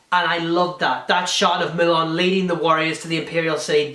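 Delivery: ordinary talk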